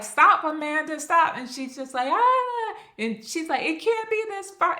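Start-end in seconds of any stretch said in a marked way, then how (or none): 0.0-1.4 louder, higher shout of stop; loud pleading yell; urgent calling. Retold or imitated. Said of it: "Jason's like". 2.1-2.7 high, loud short scream; sudden high yelp; panicked scream. Retold or imitated. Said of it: "She starts freaking out". 3.6-4.8 much higher, frantic voice; higher panicky plea; panicking. Retold or imitated. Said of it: "She starts freaking out".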